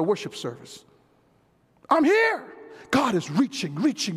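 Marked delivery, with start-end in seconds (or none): 1.9-2.5 high, sudden emphatic call; preacher's cry. Acted. God announcing himself at worship, high emphatic cry. Preacher cries out God's presence.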